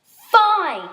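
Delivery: high, loud, drawn-out agreement; forceful acted yell. A narrator's reading. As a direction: yell it in exasperation. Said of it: "yelled Ascendia".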